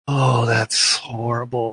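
slow, drawn-out mock dismay; appalled. Viewer watching the wife despise him, slow appalled groan. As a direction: stretch it out in appalled sympathy.

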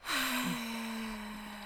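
long heavy sigh; deep sigh. Real, not acted. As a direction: let out the deepest sigh. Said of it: "the deepest of sighs".

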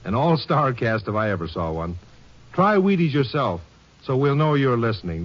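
ordinary talk, nothing marked

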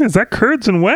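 high squeaky spider voice. Retold or imitated. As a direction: pitch way up as a tiny spider. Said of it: "That's my spider voice".

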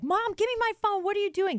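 put-on high, loud teenage protest; snatch it back, indignant and high; angry daughter. Daughter grabbing her phone back.

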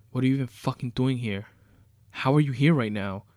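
loud incredulous demand; angry disbelief. Acted. Captive angrily questions captor.

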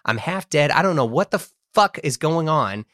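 ordinary talk, nothing marked

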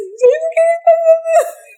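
very high, squeaky mock wail; mock tearful despair. Actor left crying after the elevator closes, hammed-up despair. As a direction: wail it in comic bewilderment. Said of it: "fui ficando mais desesperada ainda".

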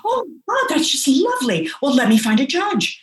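high, gushing imitation of a clerk; delighted registrar. Registrar hearing the couple will marry now, imitated delight.

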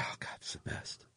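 whispered, voiceless swoon; hushed delight. Real, not acted. Co-host gushing about hearing accents, hushed delight.

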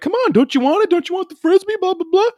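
high-pitched mock dog-owner voice; coaxing a dog. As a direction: imitate a man egging his dog on in a high voice. Retelling man coaxing his dog.

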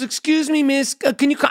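a hint of a pushy old woman; old lady voice.

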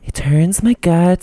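slow, high affected singer voice; mannered pop-star imitation. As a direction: stretch it out in a weird singer voice. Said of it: "weird whisper voice".

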